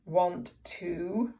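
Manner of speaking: ordinary delivery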